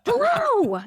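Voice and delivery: high, hollered hello; hammed-up overexcited shout; imitated yelling. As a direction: yell a manic greeting at a stranger. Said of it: "just yelling at him".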